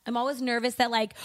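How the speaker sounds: ordinary talk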